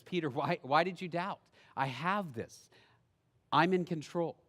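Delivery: quiet reassurance. Acted. Jesus reassuring Peter softly.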